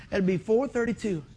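ordinary talk, nothing marked

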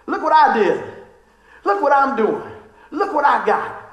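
high, loud show-off calling look at me; mock a bragging child begging for attention; mocking braggart. Mocking the world's self-focus.

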